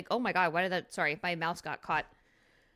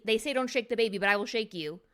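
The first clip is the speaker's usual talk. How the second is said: ordinary talk, nothing marked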